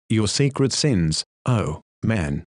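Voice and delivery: ordinary delivery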